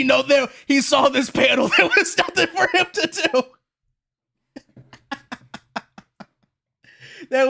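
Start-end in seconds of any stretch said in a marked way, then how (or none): 1.7-3.4 words broken up by real laughter; laughing. Real, not acted. Laughing at the manga panel. 5.1-5.8 a short real burst of laughter; just laugh out at the panel. Real, not acted.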